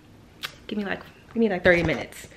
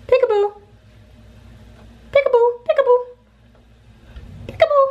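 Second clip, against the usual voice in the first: playful peekaboo; much faster than usual. Real, not acted. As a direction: play peekaboo in a very high, bright voice. Playing peekaboo with baby.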